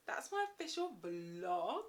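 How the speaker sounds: ordinary delivery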